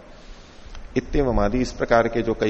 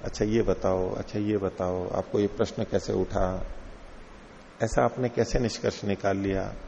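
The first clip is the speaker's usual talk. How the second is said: soft, coaxing teacher drawing a student out; quiet, playful questioning of a doubter; coaxing questions; 4 semitones lower, 6 dB quieter than usual. Shown as a demonstration. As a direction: ask gently as if leading him on. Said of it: "खेल खिलाते हैं ना जैसे".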